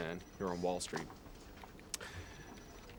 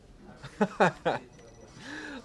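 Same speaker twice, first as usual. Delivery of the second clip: high put-on chuckle into the phone; hammed-up schmoozing laugh; forced laugh; 9 semitones higher than usual. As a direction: laugh along like old pals. Fake laugh after greeting.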